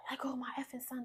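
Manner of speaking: hushed, hissed whisper of a threat; mock whispered threat; retold whispered threat. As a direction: whisper his words like a menace. Abuser's words retold in whisper.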